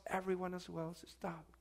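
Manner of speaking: usual speaking voice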